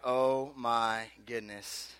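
very slow, low and soft; stunned realisation. Retold or imitated. Crier spotting his buddies, stunned quiet. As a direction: drop to a slow, deflated hush.

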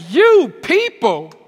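loud, high, slow street challenge. Acted. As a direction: get up in her face and call her out. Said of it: "I'd have got all up in my flesh and been like".

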